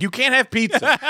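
voiced high, laughing judge; squeaky mock court official. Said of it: "they're like".

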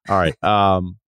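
ordinary talk, nothing marked

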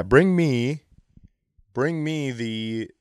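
usual speaking voice

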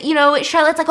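usual speaking voice

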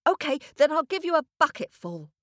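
lightly voiced small boy; boy bargaining. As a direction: offer it like a hopeful child. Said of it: "said the boy".

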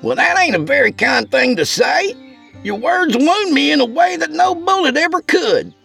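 high, wounded pout. A narrator's reading. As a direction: sulk in a high character voice. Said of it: "Craig pouted".

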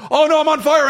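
hammed-up burning victim cry; mock panic. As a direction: play a man on fire, half-joking. Repeats the on-fire voice.

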